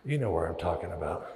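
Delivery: ordinary delivery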